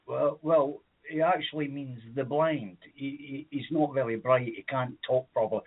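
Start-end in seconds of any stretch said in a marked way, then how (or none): none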